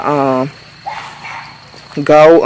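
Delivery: usual speaking voice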